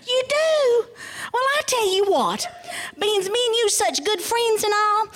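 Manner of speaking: high, eager rabbit voice; high-pitched trickster making an offer; wheedling rabbit. Acted. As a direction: sweet-talk the bear in a high pitch. Rabbit cons the bear.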